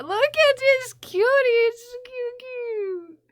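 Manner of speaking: high cooing voice for something adorable. Real, not acted. Cooing at a cute thing.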